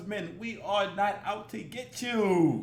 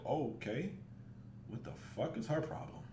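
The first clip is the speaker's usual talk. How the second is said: much softer, lower reaction; wary aside; 5 semitones lower, 12 dB quieter than usual. Acted. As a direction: say it under your breath as a puzzled bystander. Voices a wary sane bystander.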